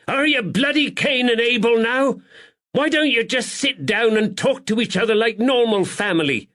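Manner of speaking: high, loud furious yell at the brothers; furious landlady. Acted. Landlady screams at the two fighting brothers, furious. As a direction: screech it like an outraged landlady. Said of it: "The furious landlady".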